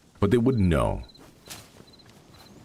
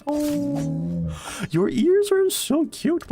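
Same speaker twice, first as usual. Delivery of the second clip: cooing over it; 6 dB louder, much slower than usual. Real, not acted. Streamer spotting a cute saber tooth in game, high cooing. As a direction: gush over a tiny cute creature.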